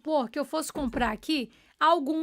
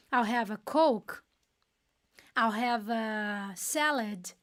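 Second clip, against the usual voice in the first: "I'll have" said slowly as "aw hev", lower; slow model orders; 4 semitones lower, much slower than usual. Shown as a demonstration. Teacher modelling restaurant orders, slow and low. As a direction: order calmly, one slow phrase at a time. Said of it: "Então, I'll have".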